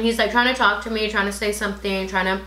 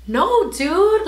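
emphatic correction; 9 semitones higher, 4 dB louder than usual. Retold or imitated. Jenny correcting her.

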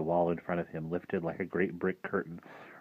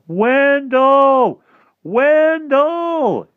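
long, high, full-voiced call; yelling; 16 dB louder, much slower than usual. A narrator's reading. Harvey calling for his missing friend, yelling. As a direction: call far across the grounds, stretched out. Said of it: "cupped his hands around his mouth and yelled".

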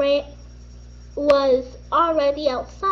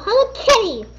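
high loud yell; 7 semitones higher, 5 dB louder, faster than usual. Acted. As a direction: yell her name at her.

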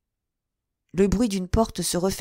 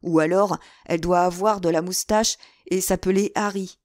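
usual speaking voice

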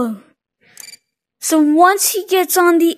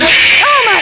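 startled yelp; 7 semitones higher, 4 dB louder than usual. Real, not acted. Startled in horror game.

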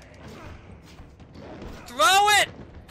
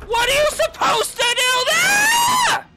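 loud, high, drawn-out screech of frustration; raging scream; 10 semitones higher, 5 dB louder than usual. Real, not acted. Gamer protesting an unfair death, raging scream. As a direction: scream it, stretching the last word out. Rage at unfair game move.

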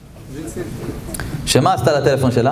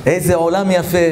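ordinary delivery

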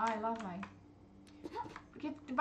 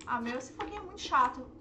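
ordinary talk, nothing marked

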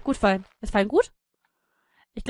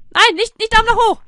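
high, louder cry of no; alarmed protest; 11 semitones higher, 9 dB louder than usual. Real, not acted. Player stopping a wrong thumbs-up, alarmed. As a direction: object urgently.

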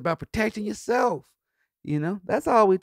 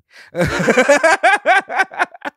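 loud high laughter; hearty laugh; 12 semitones higher, 10 dB louder than usual. Real, not acted. Comedian laughing at wanting good money, loud laugh.

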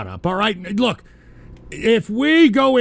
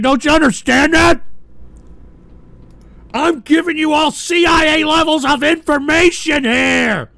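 shouting at the chat, fed up; angry rant; 7 semitones higher, 5 dB louder than usual. Acted. Ranting host yells at his chat, furious shout. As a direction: yell it out in mock fury.

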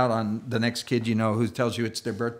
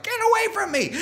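put-on squealing kid; 3 dB louder, faster than usual. Kid recoils from joke.

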